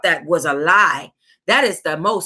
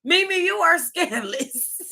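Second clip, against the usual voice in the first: soft laughing scold; teasing through a laugh; 9 semitones higher, 4 dB quieter than usual. Real, not acted. Laughing at the author.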